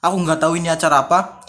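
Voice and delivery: ordinary delivery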